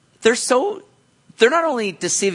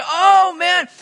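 sudden high, loud "oh man"; excited relief; 13 semitones higher, 7 dB louder than usual. Retold or imitated. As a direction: burst out in happy relief. You're like.

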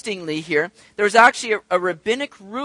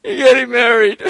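mock crying; 4 semitones higher, 6 dB louder than usual.

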